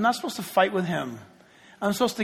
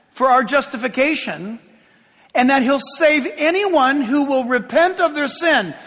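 full-force gospel preaching, louder and higher; 5 semitones higher, 6 dB louder than usual. Real, not acted. Preacher proclaiming the gospel to everyone in the room, forceful preaching. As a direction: proclaim it to the whole room with rising urgency.

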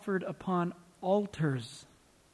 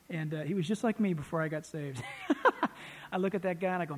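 normal talking voice

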